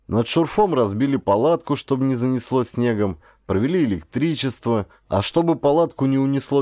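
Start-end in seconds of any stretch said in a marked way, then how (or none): none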